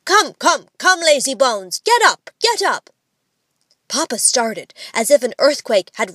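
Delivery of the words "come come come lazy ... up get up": high, loud, brisk urging; insistent call, climbing higher; brisk wake-up call. A narrator's reading. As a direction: rouse a sleeper with sharp calls. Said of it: "saying briskly".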